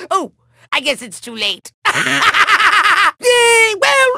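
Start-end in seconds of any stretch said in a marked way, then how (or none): 1.8-3.1 high burst of evil laughter; villain cackle. Acted. Villain unable to hold back, high mocking cackle.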